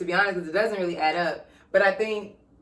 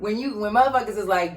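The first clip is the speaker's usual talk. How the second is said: normal talking voice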